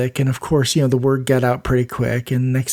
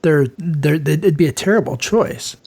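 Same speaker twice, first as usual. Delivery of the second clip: normal talking voice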